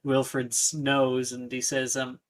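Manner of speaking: ordinary delivery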